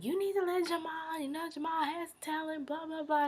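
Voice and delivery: high-pitched retold scolding; mock angry rant. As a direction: go off on him, imitating Cookie. Cookie going off on him.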